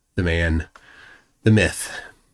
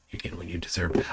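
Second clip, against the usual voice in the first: whispered, much softer repeat; 3 semitones lower, 11 dB quieter, faster than usual. Real, not acted. Streamer repeating it to Dave in a whisper. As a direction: breathe it out like a secret jab.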